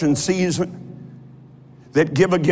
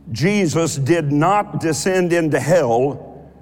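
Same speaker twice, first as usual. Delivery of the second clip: usual speaking voice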